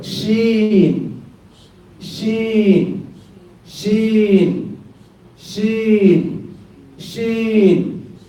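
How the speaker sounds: sheen sung out high, each one falling; drilled letter name. Shown as a demonstration. Teacher drilling the Arabic letter for students, chanting high. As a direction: chant "sheen" high and clear for the class to copy. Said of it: "The next one".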